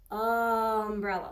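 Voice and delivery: "Umbrella" said very slowly; slow sounding out. Shown as a demonstration. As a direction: draw out the first vowel of "Umbrella". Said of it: "Listen to that beginning sound".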